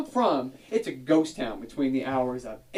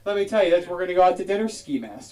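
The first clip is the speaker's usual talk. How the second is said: ordinary delivery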